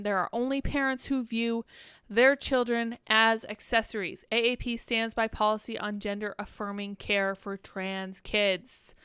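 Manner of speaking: ordinary delivery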